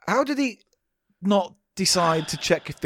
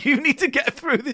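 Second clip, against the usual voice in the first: giggling through the words; can't hold back the giggles; cracking up mid-line; 9 semitones higher than usual. Real, not acted. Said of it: "I've got the giggles".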